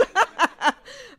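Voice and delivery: high, loud embarrassed laugh; burst of laughter. Real, not acted. Laughs at her own incompetence.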